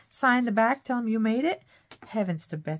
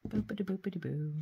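sing-song boopity, a bit softer; playful nonsense; 6 dB quieter, faster than usual. Real, not acted. Crafter gluing paper, chanting a playful rhyme. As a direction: make a silly magic-word chant.

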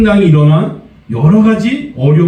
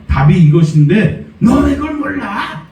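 put-on mother scolding child; 7 semitones higher than usual. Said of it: "막 애를 막 잡들이하죠".

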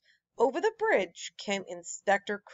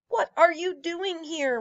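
a lightly voiced sneer, higher; mild storybook sneer at the intruders; 7 semitones higher, 3 dB louder than usual. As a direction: look down on them, just a hint. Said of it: "Waffle said, sneering".

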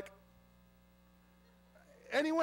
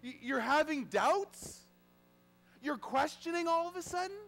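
mock incredulous; 5 semitones higher than usual. Jesus questioning followers who suddenly doubt, mock incredulous. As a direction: challenge the doubters in acted astonishment.

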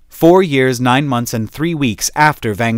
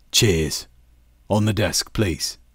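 captain; 4 semitones lower, 4 dB quieter, slower than usual. Acted. Captain voiced relaxed.

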